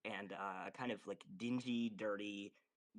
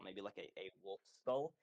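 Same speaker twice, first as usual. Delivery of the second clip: usual speaking voice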